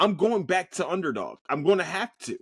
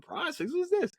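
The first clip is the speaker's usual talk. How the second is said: surprised; 4 dB quieter than usual. Retold or imitated. Surprised at Prize Picks.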